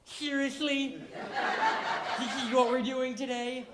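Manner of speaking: high, loud disbelief; can't believe he's making us do this; incredulous. Retold or imitated. Teen asking grandfather in high, loud disbelief.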